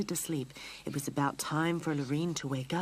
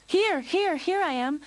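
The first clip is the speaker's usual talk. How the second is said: much higher, loud eager reply; calling back; 13 semitones higher, 8 dB louder than usual. Acted. Lionel answering his mother's call, loud. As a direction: answer Mom from afar.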